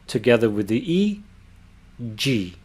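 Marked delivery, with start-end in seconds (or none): 2.1-2.5 G said as a soft palatal jee; soft J sound. Shown as a demonstration. Teacher models the palatalized D in grande for students. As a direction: soften the D into a J. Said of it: "a soft J sound".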